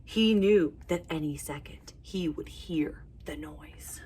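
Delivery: soft unvoiced suspense whisper; storyteller whispering the suspense to kids. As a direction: whisper it to build the tension.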